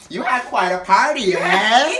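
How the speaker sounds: loud, high imitation of a tipsy woman; mock drunken small talk, pushed loud. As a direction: lift the voice and boom the final "eh". Imitating tipsy cleaner's repeated remark.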